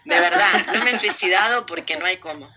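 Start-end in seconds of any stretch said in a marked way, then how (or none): 0.1-0.7 laughing through the words; amused laugh in the voice. Real, not acted. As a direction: giggle while telling it. Laughing while describing.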